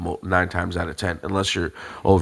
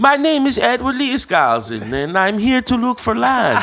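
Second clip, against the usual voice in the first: high, put-on Latvian accent, improvised; made-up Latvian accent; 4 dB louder than usual. Asked to keep going, introducing himself to the farmer in a high mock Latvian accent. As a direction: ham up a mock Latvian immigrant voice, a laugh slipping in at the end. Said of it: "Just keep doing it".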